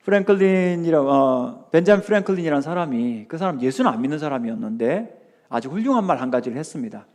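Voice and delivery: ordinary delivery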